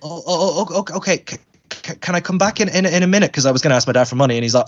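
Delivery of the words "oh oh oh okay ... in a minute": higher, stammering and unsure; mock timid kid tripping over the words; imitating flustered child. Refused child asks to come back with money, flustered.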